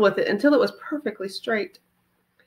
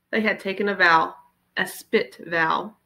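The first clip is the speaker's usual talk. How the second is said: ordinary delivery, nothing marked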